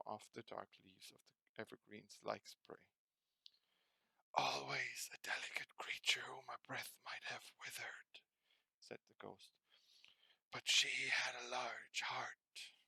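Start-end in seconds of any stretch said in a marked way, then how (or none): 4.3-8.2 hushed, breathy spirit voice; the Ghost of Christmas Past whispering to Scrooge; ghostly whisper. Acted. 10.5-12.7 hushed, breathy spirit voice; the Ghost of Christmas Past whispering to Scrooge; ghostly whisper. Acted.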